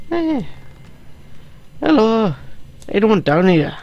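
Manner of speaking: high-pitched, muddled Irish-Scottish lilt; Irish-Scottish accent. Acted. Player calling for anyone down below, Irish-Scottish accent. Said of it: "It's a bit Irish and a bit Scottish".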